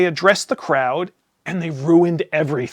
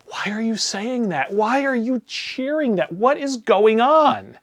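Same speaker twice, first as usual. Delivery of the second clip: incredulous; 6 semitones higher, slower than usual. Retold or imitated. Disbelief at cheering crowd.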